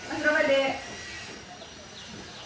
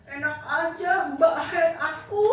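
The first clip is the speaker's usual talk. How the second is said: put-on high, laughing boy's jeer; mock the sister in a high giggly voice; teasing little brother; 9 semitones higher than usual. Little brother teasing his sister about the gift, high and laughing.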